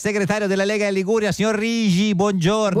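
high, sustained announcer intro; high-pitched ceremonial roll-call; announcer introduction. Acted. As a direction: present the guest with grand radio formality.

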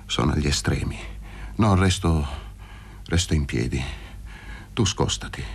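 low, halting, spent voice; dying man Luca. Acted. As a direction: ward him off with failing strength. Dying Luca rebuffs Paroni.

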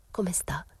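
very soft whisper; murmured. A narrator's reading. Momina to the mother on the step, murmuring. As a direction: ask discreetly, barely voiced. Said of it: "Momina mormorò sul gradino".

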